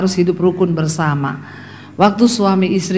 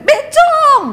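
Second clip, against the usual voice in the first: retold yell. Husband startled at night by wife's long hair, high mock yell. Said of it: "dia teriak".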